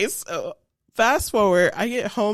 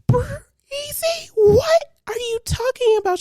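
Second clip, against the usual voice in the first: much higher, disbelieving mom voice; incredulous mom; 3 dB louder, slower than usual. Retold or imitated. Mom asking her daughter, much higher and incredulous. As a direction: squeal it out in disbelief. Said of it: "And my mom was like".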